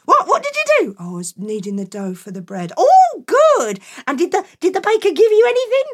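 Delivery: high, loud, eager mum; delighted mum. Acted. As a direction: gush over him, quick and pleased. Mum quizzing Jack.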